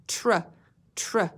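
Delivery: tr sounded out alone, twice; isolated tr blend. Shown as a demonstration. Teacher to class, sounding out "tr" before trouble. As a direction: stretch out the "tr" sound. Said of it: "beginning blend like tracks had".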